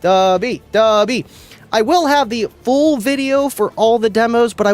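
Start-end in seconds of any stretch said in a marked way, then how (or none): none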